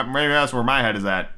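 ordinary delivery

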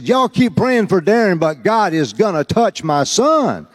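bubbling over with hope for her son; joyful mother's declaration; excited. Retold or imitated. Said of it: "She was so excited".

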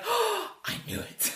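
high, slow, drawn out Oh I knew it; knowing gasp. Retold or imitated. Player catching the secret, high and slow. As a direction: let it dawn slowly, up high. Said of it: "you're like".

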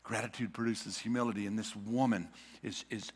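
usual speaking voice

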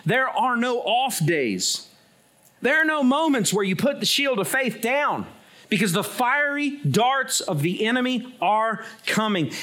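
high, driving pulpit exhortation; rally the congregation with insistent, building repetition; forceful preaching. Real, not acted. Preacher urging believers to keep fighting, forceful preaching.

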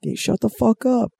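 high mock yell; yelled from a house. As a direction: shout from a window. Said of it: "Someone yelled out".

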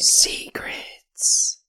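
spooky playful whisper; mock whisper. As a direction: whisper it like a ghost story. Player echoes GM teasingly.

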